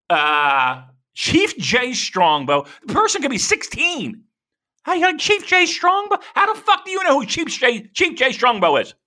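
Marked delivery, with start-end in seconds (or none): 4.8-8.9 high, far, mock-incredulous fan voice; mock clueless fan. Mocking a clueless outsider who quizzes a wrestling fan, pitched high. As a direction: play the baffled know-nothing asking about wrestlers.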